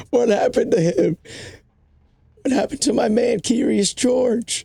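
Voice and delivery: a high, fretful sidekick asking where George went; high worried character voice. Acted. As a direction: pitch way up and stretch out the name. Worried friend asks about George.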